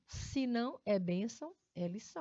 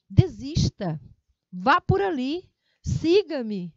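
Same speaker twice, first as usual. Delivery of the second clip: loud, forceful order with exclamation; emphatic command; 4 semitones higher, 10 dB louder, slower than usual. Shown as a demonstration. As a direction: bark it out like an order, high and loud. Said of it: "Ele dá esse tom".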